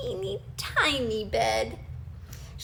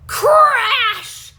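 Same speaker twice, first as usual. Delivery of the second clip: loud crash; 11 semitones higher, 12 dB louder than usual. A narrator's reading. Narrator shouting the crash to children. Chair breaks.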